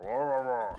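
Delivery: loud grunting brute, wah wah. Retold or imitated. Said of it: "He's like".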